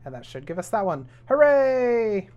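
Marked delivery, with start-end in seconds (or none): 1.3-2.3 high, loud drawn-out hooray; celebratory cheer. Real, not acted. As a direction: cheer it out, stretching the end. Cheers for science gained.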